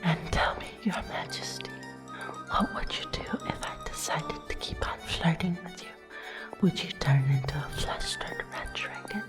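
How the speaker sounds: soft, half-whispered flirting; flirtatious teasing. Acted. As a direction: tease your dance partner quietly and intimately. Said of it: "Teasing you makes it all the more fun".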